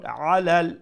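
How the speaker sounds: আলাল opening on a squeezed ayn from mid-throat; recited আলাল with a firm throat ayn; middle of throat. Shown as a demonstration. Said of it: "pronounce it from the middle of the throat".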